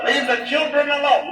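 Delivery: mother's shout. Retold or imitated. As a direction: mom stepping in for the kids. Mother defending the kids.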